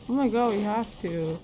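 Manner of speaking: ordinary talk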